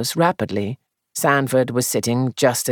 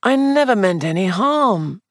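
pleading, self-pitying and higher; piteous; 8 semitones higher than usual. Acted. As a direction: plead it pitifully. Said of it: "he said piteously".

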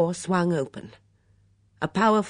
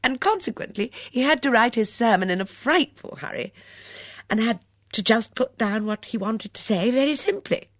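lively gushing chatter with swooping emphasis; lightly voiced excitable talker, pitched up; chatty, eager guest; 5 semitones higher, 4 dB louder than usual. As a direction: rattle on eagerly, then catch yourself. Chatty character in the story.

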